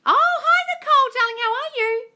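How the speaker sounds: very high, excited hello; squealed greeting. Real, not acted. Coach welcoming a viewer to the live, squealed greeting. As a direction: greet them with a joyful shriek.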